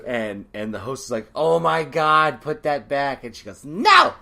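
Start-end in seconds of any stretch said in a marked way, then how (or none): 1.3-3.2 loud, drawn-out mock exclamation; loud and stretched, playing up the horror; retold alarm. Retelling host's alarmed reaction. 3.6-4.1 high, loud shouted refusal; sudden high-pitched yelp of refusal; defiant yell. Retold or imitated. Drunk girl refuses in story.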